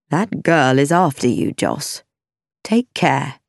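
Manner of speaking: a low word of caution, man to man; father's warning. A narrator's reading. Mister Sedley to his son after dinner, low warning.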